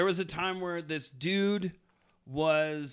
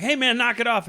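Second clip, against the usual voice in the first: higher, louder warning; firm callout; 7 semitones higher, 8 dB louder than usual. Retold or imitated. Husband warning the groundskeeper, higher and louder. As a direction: tell him to back off, loudly. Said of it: "be like".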